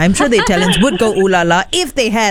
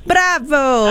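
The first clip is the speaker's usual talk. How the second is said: hammed-up Italian cheer; Italian accent; 7 semitones higher than usual. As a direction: shout it like an opera fan. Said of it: "say it in the most Italian accent".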